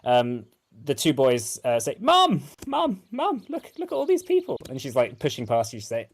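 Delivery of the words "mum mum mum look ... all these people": high, fast, excited shouting for Mum; excited kids calling. Acted. Young boys calling excitedly to their mum about the visitors. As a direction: squeal for Mum, thrilled at the visitors.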